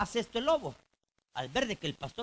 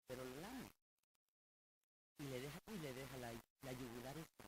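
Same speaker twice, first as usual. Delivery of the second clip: hushed; 5 semitones lower, 13 dB quieter than usual. Acted. Wolf licking the sheep's neck.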